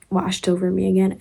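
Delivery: normal talking voice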